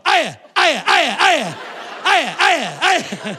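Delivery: loud, high repeated yelps; barked greetings in eager bursts; yapping puppy greeting. Acted. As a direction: pester them with puppy-like hellos.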